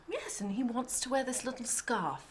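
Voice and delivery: a hint of a British accent, light and quick; lightly imitating her British accent. Director retelling the designer about the scarf, hint of British accent. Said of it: "I can't really do her accent".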